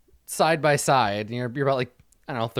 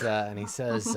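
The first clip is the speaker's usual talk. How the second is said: normal talking voice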